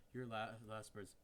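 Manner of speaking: slow, raspy game-monster noise with laughs; fnaf sound effect. Shown as a demonstration. Trying the fnaf sound effect for the viewers, a slow raspy monster noise. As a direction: rasp it out like a horror game jumpscare. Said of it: "the fnaf sound effect".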